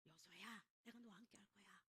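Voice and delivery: soft, low, near-whispered promise; hushed reassurance. Acted. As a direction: comfort someone frightened, almost whispering. God reassures fearful Joshua, acted. (낮게 속삭이듯 안심시키는 말)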